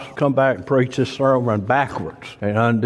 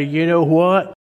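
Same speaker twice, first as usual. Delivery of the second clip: joyful at death; 5 semitones higher, 4 dB louder, slower than usual. Pretend. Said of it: "I want you to embrace it and say".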